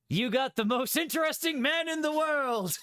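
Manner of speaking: big, high game-show reveal; excited announcement. Acted. Reading out his quiz result to viewers, excited. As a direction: announce it like the grand prize winner.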